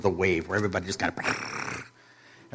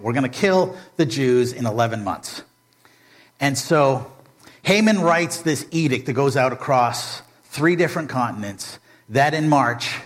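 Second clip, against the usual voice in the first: usual speaking voice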